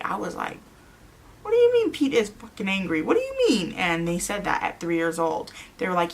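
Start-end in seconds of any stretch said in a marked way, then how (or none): 1.4-3.7 high-pitched startled questioning; retold shocked outburst, pitched high; alarmed disbelief. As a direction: react in shock, voice jumping way up. Mother reacting to child's alarming words.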